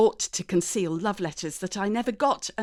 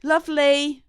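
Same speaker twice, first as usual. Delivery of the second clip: called out brightly, louder; calling back; 7 semitones higher, 10 dB louder than usual. Acted. Daughter calling back to Mum downstairs, raised voice.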